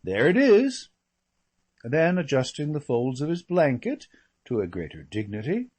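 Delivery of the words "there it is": higher and louder, a final settled verdict; firm last word, up and loud; conclusive. Acted. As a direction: close the matter for good. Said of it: "with an air of conclusiveness".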